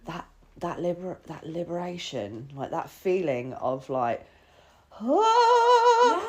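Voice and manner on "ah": a high, sung ah of pure joy; sung high ah of relief. Real, not acted. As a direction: let out one long sung note of release. Joyful release of cutting off.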